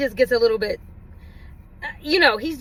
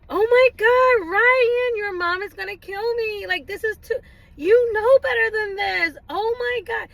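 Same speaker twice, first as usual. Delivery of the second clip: much higher, slow, dramatic scolding; over-exaggerated dismay; 8 semitones higher, slower than usual. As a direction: ham up the horror on purpose. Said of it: "to over-exaggerate when there's a point that needs to be made".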